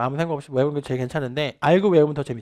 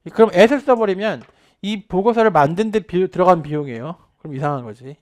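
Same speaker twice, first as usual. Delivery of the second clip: ordinary talk, nothing marked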